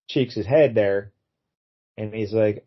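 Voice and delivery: usual speaking voice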